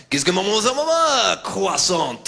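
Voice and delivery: French babble rising high on maman; mock French with a random French word; fake French gibberish. Stranger asking directions, mocked in a French accent. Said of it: "When I try and impersonate French".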